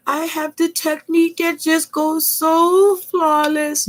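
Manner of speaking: very high, precious put-on voice; mock perfectionist crafter. Crafter mocks those with flawless technique, high fussy voice. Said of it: "I'm not one of those particularly snickety".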